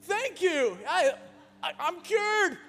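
hammed-up cheer, thanking someone who didn't help; sarcastic mock relief. Sarcastic reply to "just calm down.

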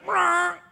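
high drawn-out bird squawk; sudden piercing parrot cry. Acted. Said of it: "with a smirk on his beak, that parrot".

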